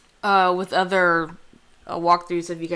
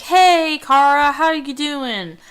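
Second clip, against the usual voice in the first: louder, higher, bubbly friend calling a hello; cheery friend greeting; 8 semitones higher, 8 dB louder, slower than usual. Pretend. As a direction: greet her like an old pal arriving late. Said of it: "the friend can come up and be like".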